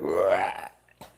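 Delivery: low mock growl of rage; mock angry growl. Telling viewers how people get mad, mock angry growl. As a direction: imitate someone growling in anger. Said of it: "when you get mad, you're like".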